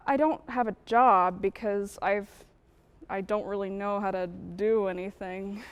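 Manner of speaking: low, soft admission; subdued confession. Acted. Housewife admitting she has no job or skills, subdued. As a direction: confess it quietly, voice dropping low.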